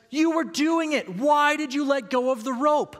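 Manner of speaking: put-on high, loud cheer to the kid; delighted encouragement. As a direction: call out to the kid in happy disbelief. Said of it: "a mixture of delight and encouragement".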